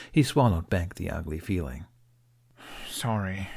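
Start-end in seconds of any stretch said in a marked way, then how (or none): 2.9-3.5 whispered, embarrassed apology; muttered. A narrator's reading. Hiccup apologizes to Toothless, whispered mutter. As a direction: breathe the apology almost without voice. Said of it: "Hiccup muttered".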